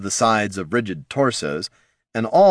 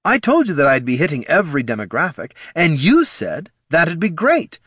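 higher, emphatic salesman defending himself; head of marketing; 8 semitones higher, 4 dB louder than usual. Acted. Head of marketing defends his pitch to Tyron, indignant. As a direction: argue back, pitched up and indignant.

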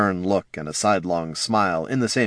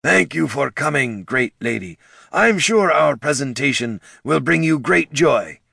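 higher, louder salesman-like orc; eager orc presenter; 7 semitones higher, 3 dB louder than usual. A narrator's reading. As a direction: pitch it like an eager pitchman. Portly orc presenter greeting.

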